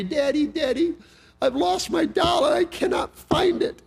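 put-on high, upset little girl crying for her doll; high panicked child's cry; little girl's voice. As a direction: pitch way up like a frantic child. Little daughter crying for lost doll.

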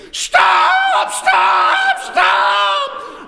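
full-force high, drawn-out scream. Retold or imitated. Father to son driving toward a semi, screaming in panic. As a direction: scream it as if about to crash. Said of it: "and I'm screaming".

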